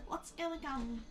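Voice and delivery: little voice in head. Acted. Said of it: "a little voice in your, in your head".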